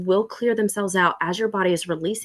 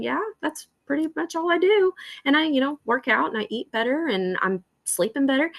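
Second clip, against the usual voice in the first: nonchalant humble brag; 6 semitones higher than usual. Pretend. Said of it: "you're just going".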